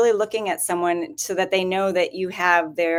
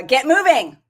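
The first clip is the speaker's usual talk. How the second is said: raised, upbeat command; cheer; 5 semitones higher, 6 dB louder than usual. Shown as a demonstration. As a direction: call it out like a coach. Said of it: "Tell 'em what to do".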